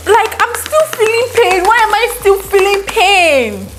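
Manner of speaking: high, indignant yelled complaint; yelling. Retold or imitated. Labouring wife yelling about failed epidural, indignant high shout. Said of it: "And I was yelling".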